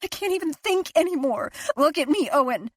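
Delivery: unravelling wife, near hysteria; frantic fear. A narrator's reading.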